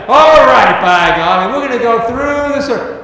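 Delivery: rally yourself like a preacher charging ahead; fired-up shout. Retold or imitated. Student preacher, inspired by the farmer, shouting with zeal.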